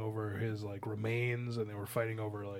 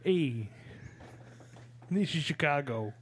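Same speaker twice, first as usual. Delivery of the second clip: Chicago accent; 2 semitones higher than usual. Shown as a demonstration. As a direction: show off your Chicago accent. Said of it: "Can you do it again?".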